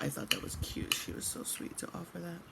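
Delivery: soft unvoiced aside; whispering. Real, not acted. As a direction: breathe it out quietly.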